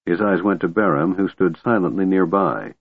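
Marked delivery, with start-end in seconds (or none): none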